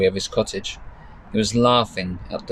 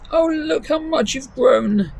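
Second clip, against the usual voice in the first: put-on much higher, slow old granny voice; doting gran; slower than usual. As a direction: grandmother fussing over her grown granddaughter. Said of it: "said Gran".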